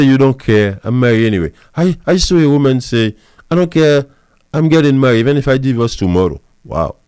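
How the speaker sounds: ordinary talk, nothing marked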